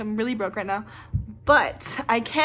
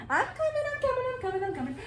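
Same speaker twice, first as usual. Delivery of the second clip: higher, sing-song call fading softer; calling out to the dog; 12 semitones higher, 7 dB quieter, much faster than usual. Real, not acted. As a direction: reassure the pet you're on your way. Answering the dog mid-video.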